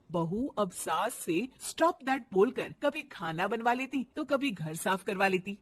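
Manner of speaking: ordinary talk, nothing marked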